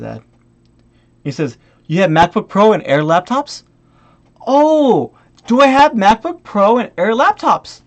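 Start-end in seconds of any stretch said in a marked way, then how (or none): 4.5-7.8 drawn-out sarcastic 'oh', then incredulous echo; exaggerated fake wondering; mocking echo. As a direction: parrot the question with scorn. Mockingly echoes the buyer's question.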